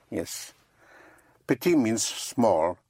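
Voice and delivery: ordinary talk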